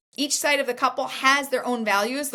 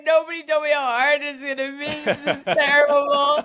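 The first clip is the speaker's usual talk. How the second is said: laughing, higher mock wail of a new bride; mock despair, laughing; 5 semitones higher, 3 dB louder than usual. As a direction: laugh through a hammed-up complaint. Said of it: "I'm like".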